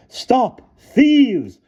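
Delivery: high loud scream. A narrator's reading. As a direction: scream it like a furious headmistress. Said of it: "screamed Missus Scrimmage".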